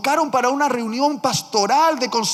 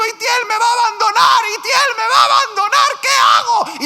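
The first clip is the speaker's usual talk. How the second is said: high, loud, fast frantic cry; panicked shout; 13 semitones higher, 8 dB louder, faster than usual. Retold or imitated. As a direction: shout like a man about to lose everything. El hombre desesperado llama al pastor. (grito desesperado, agudo, fuerte y rápido)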